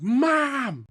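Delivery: high, loud scream into the phone; screaming mom. Retold or imitated. Son locked out, screaming for his mom over the phone. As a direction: yell for mom across the distance. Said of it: "I'm screaming".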